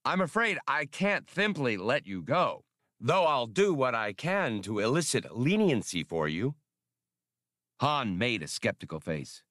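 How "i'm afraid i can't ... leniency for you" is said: fussy official voice. Acted. Spray voiced.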